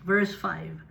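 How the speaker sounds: normal talking voice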